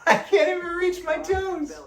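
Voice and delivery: high, laughing through the strain; high strained laughter over tight hamstrings; laughing in pain. Real, not acted. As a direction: giggle helplessly at yourself. Laughing at her own stiffness.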